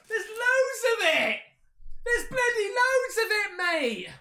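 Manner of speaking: excited amazement. Real, not acted. Vlogger thrilled at piles of merchandise, high excited exclamation. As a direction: marvel at the heap of gear.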